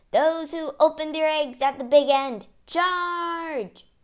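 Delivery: loud, high rallying shout; battle cry. Acted. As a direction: shout the order to attack.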